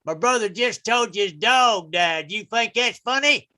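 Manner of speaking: put-on indignant Southern country boy; mock-angry Southern twang; Southern brother confronting. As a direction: challenge them in a Southern accent. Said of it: "his brother shows up".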